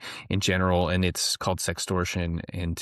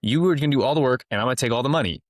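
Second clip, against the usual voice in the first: higher, louder, fast mock sales pitch; brash hammed-up con man offer; mock trafficker pitch; 6 semitones higher, 4 dB louder, faster than usual. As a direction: rattle it off like a shameless scammer pitching a scheme. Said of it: "if I showed up and said".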